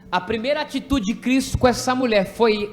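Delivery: loud, insistent preaching with punched words. Acted. Teacher drives home the lesson to children, preaching. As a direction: preach it to the class with force.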